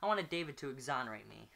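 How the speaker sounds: ordinary delivery, nothing marked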